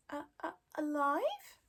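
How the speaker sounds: slow, stuttering little dragon; eager dragon. Acted. Toothless hearing about live lobsters, eager stutter. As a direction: perk up with eager hope. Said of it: "he said eagerly".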